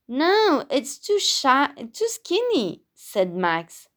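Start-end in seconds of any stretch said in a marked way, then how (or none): 0.1-2.8 picky. Acted. Max rejects tree.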